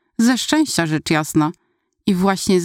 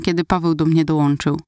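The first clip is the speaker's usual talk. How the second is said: ordinary delivery, nothing marked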